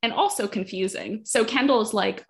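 usual speaking voice

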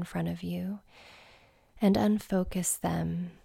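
soft, hushed meditation voice with long pauses; quiet, unhurried settling-in guidance; guided meditation. Acted. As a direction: ease listeners gently into stillness.

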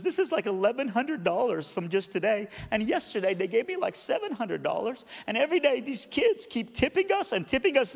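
high-pitched overwrought woman, imitated; emotional. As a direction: play the tearful cleaning lady, voice up high. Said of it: "I'm sorry to be emotional".

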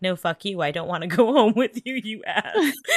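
half-laughing tell-off, hammed up; pretend angry outburst. Telling off Mon if it were me, mock angry. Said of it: "'cause I get angry".

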